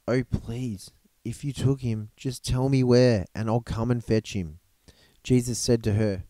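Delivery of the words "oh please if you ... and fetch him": plain reading voice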